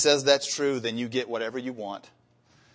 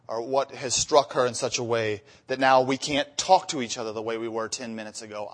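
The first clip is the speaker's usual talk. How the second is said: ordinary delivery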